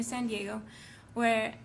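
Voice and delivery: ordinary delivery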